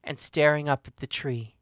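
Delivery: usual speaking voice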